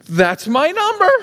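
high, rising amazed cry; acted excited disbelief. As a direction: play a winner who can't believe their luck. Acting winner seeing second number match.

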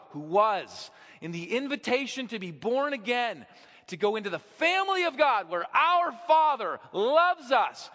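fiery pulpit declaration on fathering; full-force preaching. Acted. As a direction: proclaim it like a revival preacher.

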